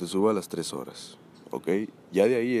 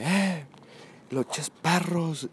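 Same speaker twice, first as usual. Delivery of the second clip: put-on high, slow, slurred drunken jab; mock drunk voice, high and dragged; 8 semitones higher, much slower than usual. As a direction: imitate the drunk friend mumbling it, stretched. Drunk friend slurring insult again.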